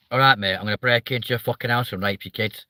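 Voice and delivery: pleasant northern English lilt on a threat; northern English accent. Retold or imitated. Streamer mimicking northerners' threats to Jonathan, friendly northern accent. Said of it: "you make it sound nice".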